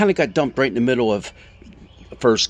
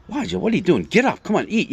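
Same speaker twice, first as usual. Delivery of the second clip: higher-pitched, brisk nagging angel; urging angel; 6 semitones higher, faster than usual. Retold or imitated. Angels rousing Elijah to eat, higher-pitched urging. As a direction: prod a sleeper to get moving. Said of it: "angels come along and say".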